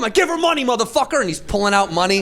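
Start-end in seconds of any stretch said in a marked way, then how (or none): 0.1-1.2 high, shouted mock command; retold yelling. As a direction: snap the order at him, pitched way up. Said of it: "I'm yelling at this guy".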